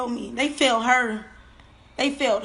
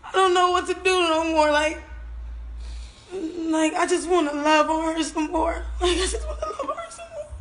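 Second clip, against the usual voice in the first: higher voice cracking into a sobbing wail; breaking down crying; 4 semitones higher than usual. Real, not acted. Mother longing for her dead child, sobbing wail. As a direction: let the grief overwhelm the words.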